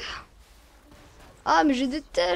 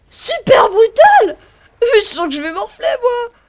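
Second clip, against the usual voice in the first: shout; 8 semitones higher, 13 dB louder than usual. Real, not acted. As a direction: yell the exclamation out. Big hit in game. (cri puissant)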